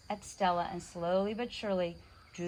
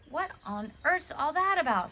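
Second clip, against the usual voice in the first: mother; 7 semitones higher, 3 dB louder than usual. A narrator's reading. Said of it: "said their mother".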